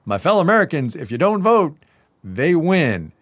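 high opening, settling as it ends; rally the crowd like a candidate; lightly hammed-up stump oratory; mock politician's speech. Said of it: "And then he goes on".

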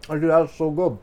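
speaking while chewing; mouth full. Real, not acted. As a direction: praise it through a mouthful. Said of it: "talking into the radio with my mouth full".

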